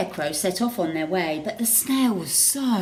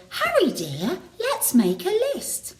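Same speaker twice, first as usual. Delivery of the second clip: Betty's voice; 11 semitones higher than usual. A narrator's reading. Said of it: "Then Betty said".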